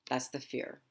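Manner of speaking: ordinary talk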